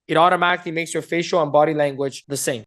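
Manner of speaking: ordinary talk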